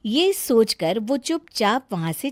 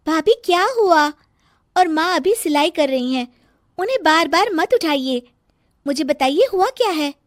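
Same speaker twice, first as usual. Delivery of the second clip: lightly voiced young girl, higher; sister-in-law Sonam; 8 semitones higher, 4 dB louder than usual. Sonam asking her sister-in-law what happened, lightly raised voice. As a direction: gently ask what happened, a touch higher.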